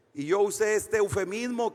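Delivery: ordinary talk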